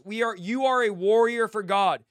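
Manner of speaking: ordinary talk, nothing marked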